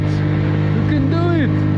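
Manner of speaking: very high, loud, slow cheer; drawn-out cheer. Real, not acted. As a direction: stretch out the cheer. Cheering friend on.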